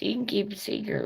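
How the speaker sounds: high, hushed and fast; imitating the neighbor asking her to keep a secret; creepy hush. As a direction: lean in with a sinister hush. Neighbor's creepy line retold.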